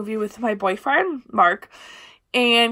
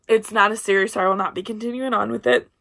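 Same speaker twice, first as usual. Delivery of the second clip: usual speaking voice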